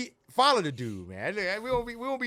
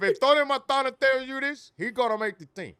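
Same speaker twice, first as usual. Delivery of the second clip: Tony Montana impression in a bad Italian accent, high and loud; hammed-up Italian gangster voice in a bad accent; 11 semitones higher, 8 dB louder than usual. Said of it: "is that Sopranos? That's, like, the worst Italian accent ever".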